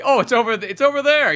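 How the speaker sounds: high, loud fobbing-off; mock evasive excuse. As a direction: point them elsewhere in a hurry, hammed-up. Cousin fobbing off, retold.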